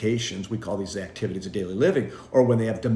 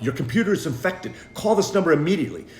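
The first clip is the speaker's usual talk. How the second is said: higher, fast alarm voice; urgent pop-up warning; 6 semitones higher, 3 dB louder, faster than usual. Retold or imitated. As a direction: read it like a scary alert.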